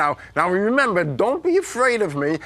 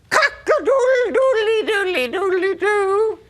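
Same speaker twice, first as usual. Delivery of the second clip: pianie koguta; 9 semitones higher than usual. Acted. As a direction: crow like a rooster in a silly animal show. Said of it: "Does a wolf sound like this?".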